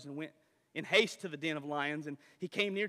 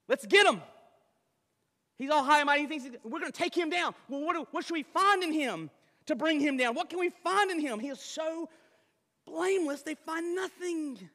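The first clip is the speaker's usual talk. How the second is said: loud, high, gleeful plotting; scheming satraps; 12 semitones higher, 10 dB louder than usual. Acted. Satraps plotting to bring Daniel down, loud and high. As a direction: play the jealous officials ganging up.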